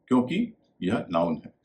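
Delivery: normal talking voice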